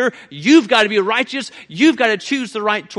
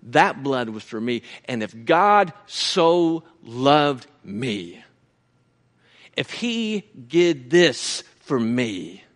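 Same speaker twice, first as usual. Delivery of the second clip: hushed sermon; 10 semitones lower, 6 dB quieter than usual. Acted. Preacher speaking tenderly to the brethren, soft and low. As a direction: bring it down to a near-hush. Preacher drops to intimate reflection.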